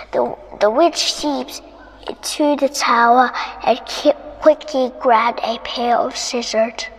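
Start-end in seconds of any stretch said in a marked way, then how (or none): none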